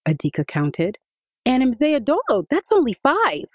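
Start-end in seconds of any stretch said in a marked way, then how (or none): 1.5-3.5 put-on high, bright child's voice, jumping out with a surprise; excited little boy. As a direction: play the eager boy popping out to surprise Mama. Adika finishes counting.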